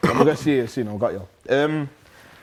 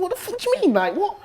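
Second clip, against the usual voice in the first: a sudden high, stunned outcry; shocked disbelief at being passed over; incredulous outrage. Retold or imitated. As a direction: replay the moment you got left out. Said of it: "And I'm thinking".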